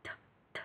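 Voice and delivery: isolated "T, t" with a soft puff of air; light breathy t. Shown as a demonstration. Teacher isolating a light breathy t for students. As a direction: tap out a breathy "t" sound on its own. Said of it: "âm gió nhẹ".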